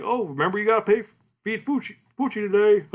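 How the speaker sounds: high, put-on neighbor voice; dog owner. Neighbor talking about the puppy, high put-on voice.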